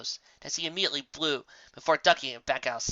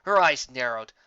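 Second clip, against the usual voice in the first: usual speaking voice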